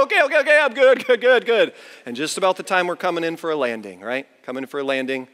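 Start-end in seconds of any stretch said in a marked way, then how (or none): 0.0-1.7 high, frantic repeated okays; acted-out dizzy panic, pitched up; mock panicked reassurance. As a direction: insist you're fine while clearly not. Begging to stop the spinning.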